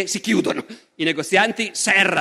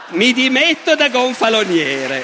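slow, raised, formal resignation; slow hammed-up resignation speech; mock solemn resignation; 4 semitones higher, 4 dB louder than usual. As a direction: announce it like a proud official quitting. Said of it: "lui dice che si dimette".

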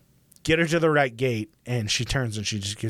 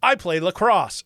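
mock ad testimonial, louder and higher; commercial dude; 6 semitones higher, 5 dB louder than usual. Long-haired dude in a Utah commercial, mock proud announcement.

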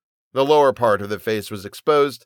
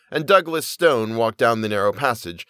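usual speaking voice

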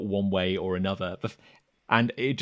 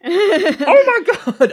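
slow, very high cry dissolving into a laugh; a hammed-up wail cracking up with laughter; laughing mock panic; 12 dB louder, much slower than usual. Villager's panicked wail breaks into laughter.

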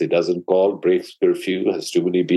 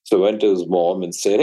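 ordinary delivery

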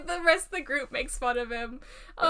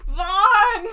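loud excited shout; 6 semitones higher, 14 dB louder than usual. Real, not acted. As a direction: call the name out loud. Calling out a favourite character.

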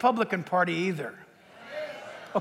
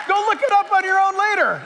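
a laugh, then spoken higher while still laughing; 7 semitones higher, 3 dB louder than usual. Real, not acted. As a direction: chuckle through the line. Preacher laughing at his own point.

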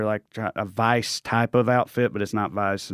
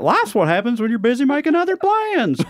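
high-pitched, hammed-up Southern twang; own Southern accent, exaggerated; 11 semitones higher than usual. Shown as a demonstration. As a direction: lay the Southern accent on thick. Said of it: "say it in my accent".

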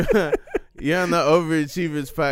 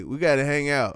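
normal talking voice

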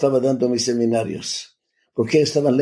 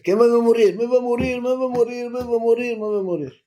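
put-on high child's voice, much higher, panicked repeating; childlike terror; 10 semitones higher, faster than usual. Six-year-old boy crying to his mother, terrified and high-pitched. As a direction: wail it like a frightened small boy sure he'll die.

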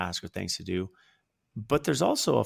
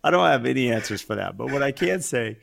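laughing; 4 semitones higher, 7 dB louder than usual. Real, not acted. Laughing reply to marriage joke.

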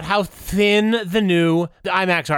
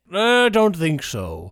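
slow mock Gandalf; much slower than usual. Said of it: "And Frodo's like".

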